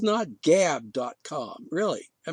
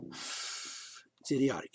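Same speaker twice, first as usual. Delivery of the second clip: muttered aside; 5 semitones lower, 9 dB quieter, much slower than usual. Real, not acted.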